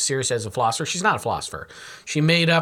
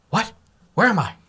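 mock bewilderment, hammed-up; Nancy Salzman; 5 semitones higher than usual. Mocking a cult leader when things turn bad, hammed-up confusion. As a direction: play dazed and confused, pitch lifting. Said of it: "here's my impression of".

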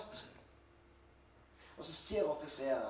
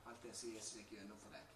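much softer, lowered voice; hushed repeat; 3 semitones lower, 9 dB quieter than usual. Real, not acted. Preacher turns to the listener, for you, soft intimate delivery. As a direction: drop the volume and bring it close.

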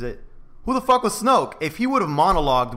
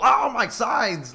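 mock pained groan; 8 semitones higher, much slower than usual. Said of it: "doing the whole".

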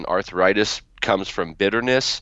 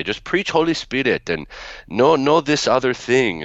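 higher-pitched mock Middle Eastern grandmother accent; grandmother's broken English; 6 semitones higher than usual. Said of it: "say that in her broken English".

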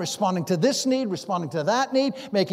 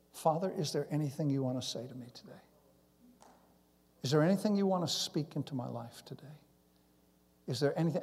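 hushed, near-whispered question to God; soft, low, intimate praying voice; quiet prayer; 4 semitones lower, 8 dB quieter, faster than usual. Retold or imitated. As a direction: ask softly, as in private prayer. Acts out his quiet morning prayer.

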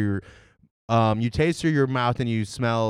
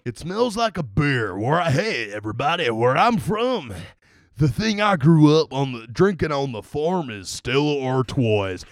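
put-on Southern accent; 3 dB louder than usual. Beer reviewer playing a farm boy reminiscing, Southern accent. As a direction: lay on a hammed-up Southern accent. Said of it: "start doing a Southern accent".

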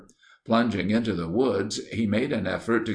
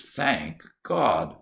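plain reading voice

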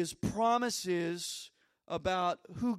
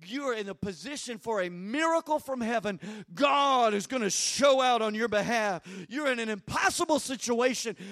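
mock-excited preacher, hitting key words hard; imitating an excited pastor; 3 semitones higher, 7 dB louder than usual. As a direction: proclaim it like a thrilled mentor. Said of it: "starts getting all excited".

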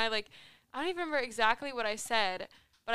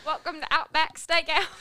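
louder, higher badly faked British accent; British accent attempt; 4 semitones higher, 5 dB louder than usual. Shown as a demonstration. Restaurant greeting performed for friends, unconvincing British accent. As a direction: greet customers in a laughing mock-British voice. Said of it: "You could do a British accent".

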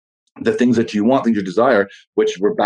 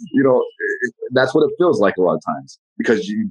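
ordinary delivery

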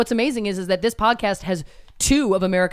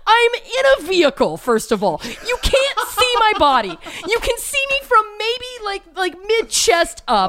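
high-pitched, drawn-out comic indignation; indignant protest; 11 semitones higher, slower than usual. Retold or imitated. Driver answers stranger's body comment, mock indignant. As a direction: protest in exaggerated disbelief, pitched way up.

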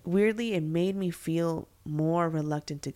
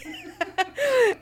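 high squealing laugh; 5 dB louder than usual. Real, not acted. [name] pointing, group laughs.

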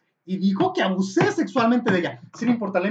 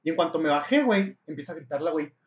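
ordinary talk

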